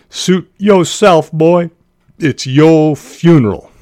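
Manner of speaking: high, loud, slow Southern drawl; acted country lawman's scornful send-off; mean Southern deputy. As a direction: dismiss him loudly, stretching the words in a Southern accent. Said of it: "his eyes grew so mean".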